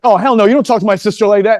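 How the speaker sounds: high, loud mock outrage; retold indignant challenge. Six-year-old standing up for his sister, acted indignant challenge.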